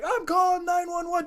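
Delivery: high-pitched anxious announcement; alarmed lady. Retold or imitated. Lady to the injured rider, high alarmed voice. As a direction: imitate her in a very high voice.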